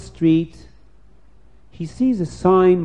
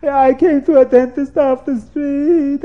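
high, loud, breaking into tears; on the verge of tears; 9 semitones higher, 7 dB louder than usual. Acted. The man admits to the dentist he walked in off the street, tearful. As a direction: wail it, close to crying. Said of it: "And he starts to cry".

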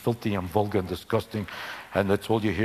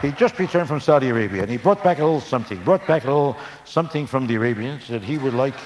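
lightly voiced Ed Sullivan. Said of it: "words of Ed Sullivan".

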